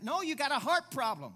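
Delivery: No, you got a heart problem shouted high and loud with heart punched; loud preaching. Acted. Pastor correcting a doubter in the congregation, loud preaching. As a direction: hit heart hard and loud from the pulpit.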